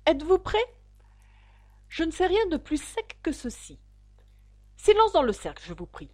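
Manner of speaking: loud, high and pompous; self-important. A narrator's reading. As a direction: puff up and command silence. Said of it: "d'un air d'importance".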